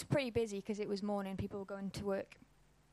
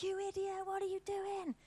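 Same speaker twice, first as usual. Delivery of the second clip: much higher, mock furious yell at the driver; imitated angry outburst; 10 semitones higher than usual. Pretend shouting at the van driver, mock furious and much higher. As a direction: play the road-rage reaction, pitched way up. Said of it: "I turn to that guy and I'm like".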